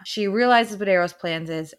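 ordinary talk

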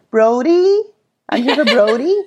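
imitated Canadian accent to be understood; put-on Canadian accent. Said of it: "Just so they could understand me".

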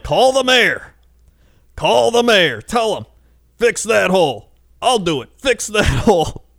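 put-on high, loud insistent old-man voice; adamant old man. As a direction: squawk it like a fussy old man demanding repairs.

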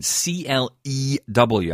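slow letter-by-letter spelling with a distinct E; carefully pronounced E. Real, not acted. Presenter spelling the app name for a confused colleague, E carefully pronounced. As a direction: spell it out slowly, pronouncing the E clearly. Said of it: "I have to pronounce the E".

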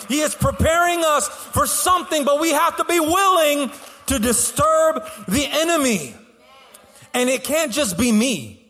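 high, driving sermon delivery; rally the congregation, pitched high and emphatic; preaching at full force. Real, not acted. Pastor challenging church to fight the enemy, fervent preaching.

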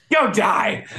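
high mock yell; retold angry send-off. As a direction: imitate a furious parting shot. Retold parting insult before hanging up.

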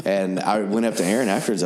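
ordinary delivery

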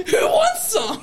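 very high, laughing imitation; laughing mock offer. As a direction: tease with a squealed offer through laughter. Mocking the teller while laughing.

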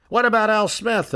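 high heckling call; delegate's shout. Retold or imitated. Another delegate shouts Al Smith's name at Bryan, high and jeering. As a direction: toss it up from the crowd.

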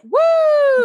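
high, loud "woo"; mimicked crowd whoop. Retelling how the crowd reacted, high mimicked whoop. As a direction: whoop like a whole stunned crowd.

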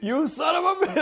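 high, loud mock outrage, laughing; outraged. Cheated-on freshman cursing his brother, high and loud. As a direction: cry it out in mock betrayal. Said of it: "I was like".

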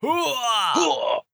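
high shout of hooah rising; gung-ho soldier hooah. Pretend. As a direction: yell it like a soldier. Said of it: "Mine sounded like I was dying".

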